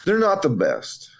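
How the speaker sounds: usual speaking voice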